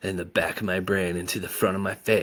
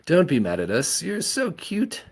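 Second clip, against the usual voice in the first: put-on drunk cooing; 9 semitones higher, 3 dB louder than usual. A narrator's reading. Drunk Jetta cooing at boy.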